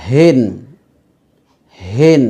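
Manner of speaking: হিন with an easy, unsqueezed h; relaxed h in হিন; plain open H. Shown as a demonstration. Contrast take with plain h.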